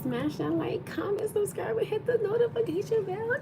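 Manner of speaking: very high, rising squeaky plug; high-pitched plug. Pretend. Streamer asking viewers to like and subscribe, high-pitched playful plug. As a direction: pitch climbing sharply through a playful plug.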